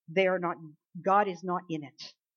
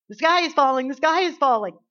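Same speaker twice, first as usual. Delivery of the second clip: loud, higher frantic hen cry; panicked Chicken Little; 5 semitones higher, 9 dB louder than usual. Retold or imitated.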